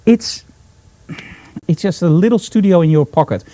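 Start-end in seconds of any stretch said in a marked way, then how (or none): none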